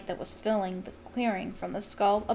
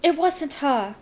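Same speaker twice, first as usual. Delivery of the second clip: put-on higher, louder nervous girl; nervous but determined; 6 semitones higher, 5 dB louder, slower than usual. A narrator's reading. Hermione speaks up to ministry wizards, nervous but firm. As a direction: speak up bravely despite nerves.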